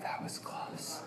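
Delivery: relieved hushed whisper. Real, not acted. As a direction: breathe it out as a secret. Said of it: "I'm gonna whisper now".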